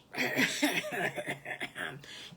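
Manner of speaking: long, exaggerated throat-clear. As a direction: clear your throat awkwardly before speaking. Farmer Brown stalls before speaking.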